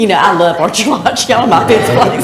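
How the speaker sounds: a real, moved laugh breaking into the words; emotional laughter. Real, not acted. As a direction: laugh it off while choked up. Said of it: "I'm getting a little emotional here".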